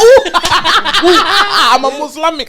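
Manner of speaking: high, loud giggly mock squeal; laughing mock gush. Friend mocking the word Muslimic, laughing squeal. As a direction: squeal it through laughter, teasing. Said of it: "I heard someone call it a Muslimic".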